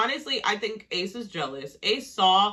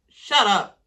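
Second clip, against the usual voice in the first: "Shut up" pushed out louder and harder; fed up with the guys, louder "Shut up"; 9 dB louder than usual. Real, not acted. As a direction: bark the repeated "Shut up". Repeated, louder.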